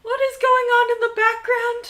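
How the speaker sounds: very high, loud disbelieving wail; amused shriek. Real, not acted. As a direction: squeal it out in laughing disbelief. Cries out at background antics.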